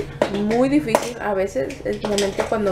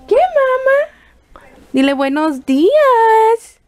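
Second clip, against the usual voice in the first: much higher, louder and slow sing-song coo; high, stretched-out motherese; 7 semitones higher, 6 dB louder, much slower than usual. Real, not acted. Mother cooing at newborn daughter.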